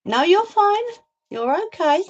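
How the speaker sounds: sing-song, high-voiced comforting; high-pitched reassurance. Real, not acted. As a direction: lift the voice right up to soothe. Reassuring someone in high pet-talk voice.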